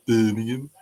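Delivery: Birmingham in a thick Brummie accent. Shown as a demonstration. Showing chat the Brummie accent: thick Brummie Birmingham. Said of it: "a Brummie accent".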